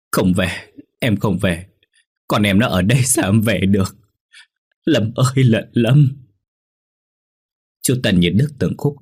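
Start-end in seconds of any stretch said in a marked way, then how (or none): 0.1-6.1 put-on mother's protest rising into a call for her child; understated refusal swelling into lament; grief-stricken refusal. A narrator's reading. Grieving mother refuses to leave, calls son.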